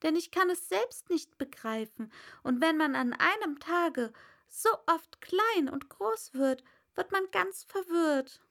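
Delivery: put-on a confused young girl; Alice. Alice voiced, high. (verwirrtes Mädchen, hohe Stimme)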